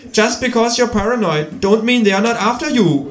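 high lecturing kangaroo voice. A narrator's reading. Kangaroo lectures on evolution in a high voice.

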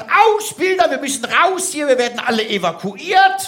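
high, rushed alarm; panicked woman. Acted. Neighbour woman runs up warning of evacuation, high and urgent. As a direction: call out the alarm, pitched high.

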